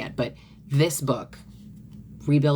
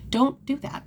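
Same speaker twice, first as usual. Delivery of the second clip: much higher, stopping yourself; gentle correction; 12 semitones higher than usual. Shown as a demonstration. Speaker stopping her own self-talk, higher mock warning. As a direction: pull yourself up short. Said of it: "it didn't work, by the way".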